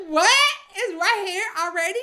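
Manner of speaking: high-pitched, laughing disbelief; can't believe it, voice shooting up; amazed disbelief. Retold or imitated. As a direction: squeal it, astonished at the reunion. Her shocked reaction to the reunion.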